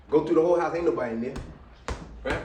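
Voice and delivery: ordinary delivery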